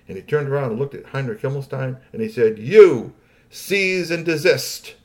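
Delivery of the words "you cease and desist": loud, drawn-out royal order; commanding. A narrator's reading. Crown prince orders Heinrich Himmelstein to stop, commanding. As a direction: stop everybody dead with a slow command. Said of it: "said it in a way that everybody stopped".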